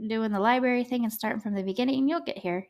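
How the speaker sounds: normal talking voice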